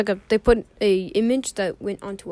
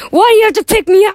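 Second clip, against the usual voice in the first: high, fast, loud complaint; loud protest; 11 semitones higher, 11 dB louder, faster than usual. Real, not acted. Protesting to a friend who threatens to lift him, loud and high. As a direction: blurt it out in high-pitched protest.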